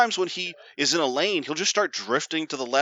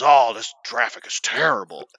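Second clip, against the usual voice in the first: loud, groused complaint; grumpy dad impression; 3 semitones lower, 5 dB louder than usual. Dad complaining about cars around him, mock disgust. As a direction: imitate a disgusted father bemoaning the drive.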